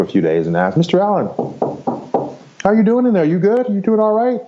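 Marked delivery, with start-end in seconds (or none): 0.8-1.3 high-pitched mock nurse calling a patient; retold nurse's singsong check-in; imitating a nurse. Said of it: "they have a nurse that comes in". 2.6-4.4 high-pitched mock nurse calling a patient; retold nurse's singsong check-in; imitating a nurse. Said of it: "they have a nurse that comes in".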